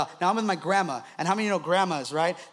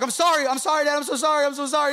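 higher, pleading imitation of a child; hammed-up panicked apology; mock freaking out; 5 semitones higher, 3 dB louder, faster than usual. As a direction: beg frantically like a kid in trouble. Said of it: "He starts freaking out".

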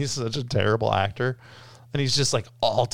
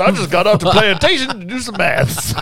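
put-on Southern accent; 6 semitones higher, 8 dB louder than usual. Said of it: "Southern Baptist lawyer".

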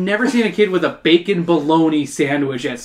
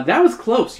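higher-pitched worried thought; 8 semitones higher than usual. A narrator's reading. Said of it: "you think worriedly".

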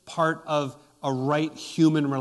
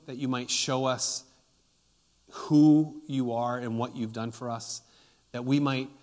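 prayer; 3 semitones lower than usual. Acted. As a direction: pray humbly before God. Pastor leads congregation in prayer.

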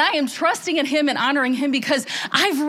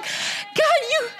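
high, amazed exclamation to God; excited awe; 10 semitones higher, slower than usual. Retold or imitated. Preacher recalling her prayer to God, excited awe. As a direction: marvel at God in a high, lifted voice. Awed talk to God about glimpses.

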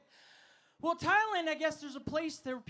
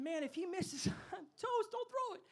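rising to a much higher pleading voice; mock panic; 5 semitones higher, 4 dB quieter than usual. Facing a man with a spear, hammed-up panicky plea. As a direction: squeak it out in fake terror. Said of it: "I'm thinking".

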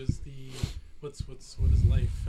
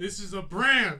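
mock announcer; 4 semitones higher, 11 dB louder than usual. Catchphrase declared, laughing.

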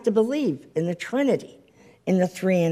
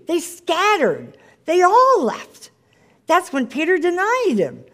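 rising preacher fervor; 9 semitones higher, 4 dB louder than usual. Real, not acted. Preacher drives home disciples fleeing.

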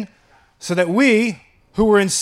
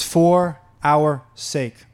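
For our sake drawn out very slowly; slow scripture read; 4 semitones lower, much slower than usual. Shown as a demonstration. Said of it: "So let's read it slow".

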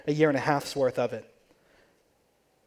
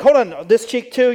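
louder, higher mock insistence; playful insistence; 5 semitones higher, 6 dB louder than usual. Friend insisting on a kiss on both cheeks, playfully loud. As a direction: lightly act out a friend demanding the other cheek.

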